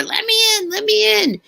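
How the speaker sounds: loud, very high pet voice; mock frantic begging of a pet at the door; pleading pet. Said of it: "He literally scratches his claws on the door".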